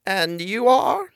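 high, slow granny prompt; a slow, high old woman's nudge; prompting old lady. Acted. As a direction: nudge her to answer. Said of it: "she prompted".

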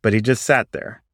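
ordinary delivery, nothing marked

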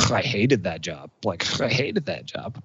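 soft, disgusted groan of complaint; quiet, put-on disgust; mock loathing. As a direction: groan it under your breath, imitating a disgruntled worker. Acting out people who hated their job.

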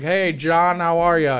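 imitated called-out greeting. Friend getting out of the car greets John, imitated loud hail. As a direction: imitate someone calling hello across a yard.

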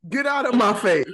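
loud, playful get out; loud teasing. Real, not acted. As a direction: yell it at her, joking. Repeats louder.

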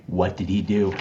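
ordinary delivery

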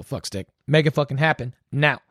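ordinary talk

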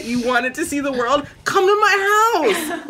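exaggerated invitation, jumping high on the last part; laughing, hammed-up imitation; incredulous mock quote. Mocks the character's absurd invitation.

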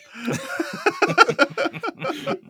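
high burst of laughter. Real, not acted. Laughing at the joke.

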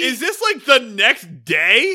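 loud question with the last words stretched; real incredulity. Real, not acted. Co-host questions the sequel's timing, incredulous. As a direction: demand it in loud disbelief.

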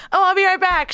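high loud chirpy mock voice; imitating her. Girlfriend leaving to pee, high bright mock voice. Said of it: "she's like".

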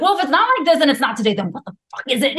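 snapping back in a rush, fed up; mad outburst. Retold or imitated. Fed up with her grandmother's voice, a fast mad retort. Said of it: "I got so mad and I was like".

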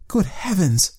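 high-pitched horrified exclamation; shocked cry. A narrator's reading. Client learning she was followed, high shocked cry. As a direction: gasp it out high, as a startled woman. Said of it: "she cried".

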